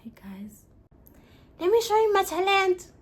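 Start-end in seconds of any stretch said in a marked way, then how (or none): 1.6-2.9 announcing. Real, not acted. Announcing her talent to viewers.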